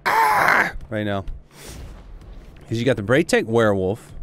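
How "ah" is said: high-pitched yelp of delight; excited shriek. Pretend. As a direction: let out a sudden excited "Ah!". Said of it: "I feel like just going".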